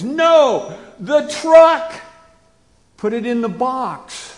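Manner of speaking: put-on a parent correcting a child, lean on truck; stress on truck. Shown as a demonstration. Parent to child tidying toys, stressing truck. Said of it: "we slightly do that in English".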